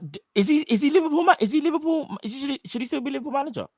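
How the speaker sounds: ordinary delivery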